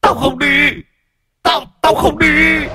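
very high shrieking scream; screaming refusal. Acted. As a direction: scream it in terror. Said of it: "mà rú lên".

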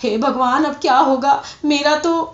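acted, plaintive cry of despair; tearful. A narrator's reading. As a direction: lament like a ruined merchant. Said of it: "پھر ایک دم رو کر بولے".

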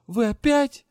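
high, loud indignant question; indignant cry. Acted. Heroine confronts the magister again, high indignant cry. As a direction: fire it back in disbelief. Heroine outraged at repeated threat.

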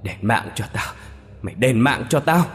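a vengeful ghost demanding payback; angry yell. Acted. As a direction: hurl the curse at him. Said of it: "giận dữ gào thét lên".

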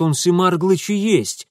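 eager shouted guess. A narrator's reading. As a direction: blurt out the wild theory loudly. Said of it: "крикнул Митя".

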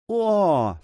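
high-pitched exclamation of alarm; monk's frightened "oh"; startled cry. A narrator's reading. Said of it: "вскричал монах".

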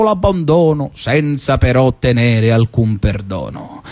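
lofty declaiming of a bawdy Dante parody; hammed-up poetry reading; mock-epic recitation. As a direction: recite it like grand verse, tongue in cheek.

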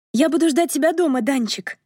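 high-pitched voice for the sister, dropping on the name; sister's parting words. A narrator's reading. Sister saying goodbye to Danka on the phone, high-voiced farewell. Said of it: "на прощание сказала сестра".

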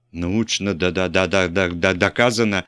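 stuck stammer on научно да-да-да-да-да-да доказано. A narrator's reading. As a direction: stammer repeating да before доказано. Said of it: "запинаясь". (запинаясь на «да-да-да»)

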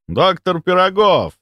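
high, drawn-out hail; loud exclamation. A narrator's reading. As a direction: call the name out too loudly for the room. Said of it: "воскликнул он".

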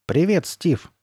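high, exclaimed hello; called greeting. A narrator's reading. Child at next table calling to a friend, exclaiming. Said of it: "раздался возглас".

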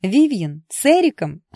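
high, loud, incredulous questions; stunned, high-pitched astonishment; disbelieving. A narrator's reading. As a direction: fire off each name in shock. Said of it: "не поверила подруга".

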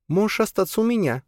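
put-on high, simple young woman; Alisa's offer. A narrator's reading. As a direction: offer it plainly, pitched high. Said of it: "предложила она". (высоким голосом, просто предлагая)

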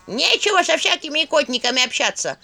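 high, displeased skull's cackle; clattering jaw cackling skull. A narrator's reading. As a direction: clatter your jaw in disapproval. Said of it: "недовольный голос Черепуши".

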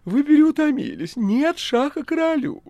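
very high, loud feigned alarm; phoney-anxious talking cat; falsely alarmed cat. A narrator's reading. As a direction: squeal with put-on worry. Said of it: "тревожно фальшивым голосом отозвался кот".

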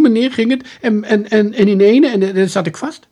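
high, loud, agitated pleading; pleading house-painter suspect. Acted. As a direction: gabble innocent excuses in a high, loud voice.

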